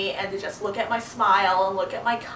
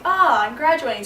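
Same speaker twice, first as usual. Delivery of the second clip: higher, louder burst of excitement; excited realization; 5 semitones higher, 6 dB louder than usual. Retold or imitated. Retelling her first thought of graduation, excited. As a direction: exclaim it in happy disbelief, higher and louder. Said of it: "went from being like".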